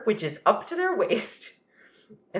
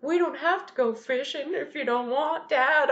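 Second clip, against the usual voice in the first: put-on sincere little kid; 6 semitones higher than usual. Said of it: "the most sincere performance".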